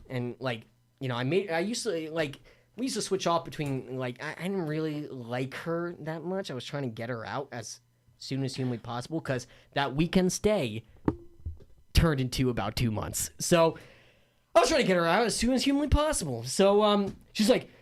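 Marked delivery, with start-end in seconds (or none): 4.2-9.2 low, soft aside; subdued admission. Real, not acted. Guest confiding he wanted the houseguest gone, quiet and low. First, muttered telling of his wish. 14.5-16.4 high-pitched, fast and insistent; emphatic repeat. Real, not acted. Guest insisting he wanted the houseguest gone, high and rushed.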